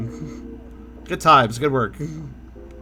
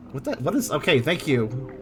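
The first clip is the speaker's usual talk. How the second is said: normal talking voice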